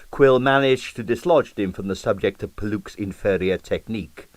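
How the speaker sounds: usual speaking voice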